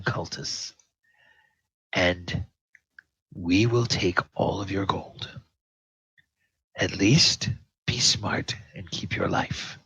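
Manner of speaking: lightly voiced captain, partly hushed, weighing each phrase; hint of a menacing captain.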